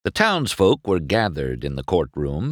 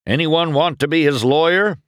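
high, loud question to a crowd; calling out; 11 semitones higher, 5 dB louder than usual. A narrator's reading. Sheriff asking assembled cars for a lawyer, calling out. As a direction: project it to the whole room. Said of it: "the sheriff called out to the assembled cars".

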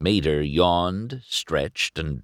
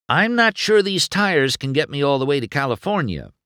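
McQueen hinting; 8 semitones higher, 3 dB louder than usual. A narrator's reading. Narrator voices McQueen hinting.

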